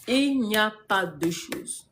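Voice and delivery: drawn out, each word weighted; slow emphatic repeat. Real, not acted. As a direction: hammer it home word by word, very slowly.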